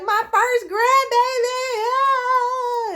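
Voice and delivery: high, drawn-out squeal of a thrilled grandma-to-be; extremely excited. Retold or imitated. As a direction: imitate mom's overjoyed squealing celebration. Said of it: "She was extremely excited".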